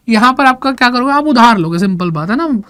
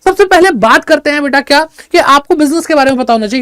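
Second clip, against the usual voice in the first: ordinary delivery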